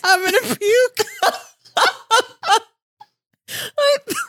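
high-pitched laughing squeal. Real, not acted. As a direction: say it mid-laughing fit. Hysterical laughter over misunderstanding.

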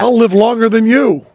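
lightly acted defiant boast; mock defiance. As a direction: crow it as a pretend victor. Voicing grandmother's defiance of the Nazis.

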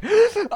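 high laughing gasp; exaggerated convulsive intake of breath; hitching sob gasp. Shown as a demonstration. Showing convulsions while watching the show, hitching gasp.

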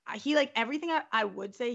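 ordinary delivery

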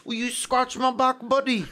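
high pleading Cockney beggar voice; Cockney street urchin. Retold or imitated. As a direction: whine like a poor London street kid. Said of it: "more like, street".

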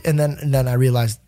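normal talking voice

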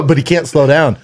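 ordinary talk, nothing marked

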